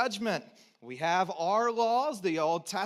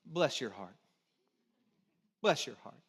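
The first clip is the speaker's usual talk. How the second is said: lightly Southern "Bless your heart", low and sweet; mild Southern sugar-coated put-down; 7 semitones lower, 3 dB quieter than usual. Retold or imitated. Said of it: "If we were in the South, this is how one would say it".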